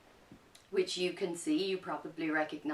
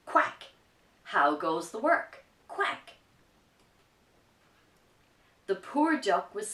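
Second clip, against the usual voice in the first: lively picture-book narration, a bit louder; reading to children; 7 dB louder than usual. A narrator's reading. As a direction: tell the story with energy for kids.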